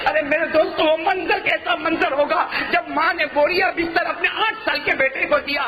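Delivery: a preacher at the emotional peak of the story; full-force preaching. Acted. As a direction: carry the crowd into the farewell scene.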